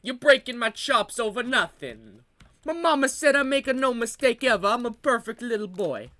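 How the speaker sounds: hammed-up Italian mama's boy; mock Italian accent. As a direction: complain in a put-on Italian accent. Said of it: "the filthy Italian hippie".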